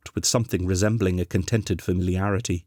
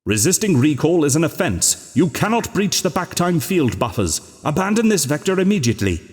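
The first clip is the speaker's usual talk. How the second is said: much higher and louder, faster at the end; urgent official warning; 8 semitones higher, 4 dB louder than usual. A narrator's reading. Control voice warning the Doctor off the vector, urgent and loud. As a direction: push the alarm harder each sentence. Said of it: "He could still hear the voice".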